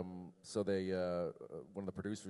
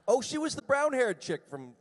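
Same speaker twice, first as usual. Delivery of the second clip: high, loud burst of pretend recognition; mock realisation; 12 semitones higher, 9 dB louder than usual. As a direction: pretend it just dawned on you, loudly. Mock realisation about a co-star.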